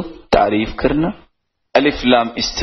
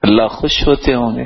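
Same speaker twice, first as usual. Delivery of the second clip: normal talking voice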